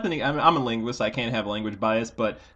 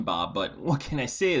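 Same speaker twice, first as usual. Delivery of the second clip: normal talking voice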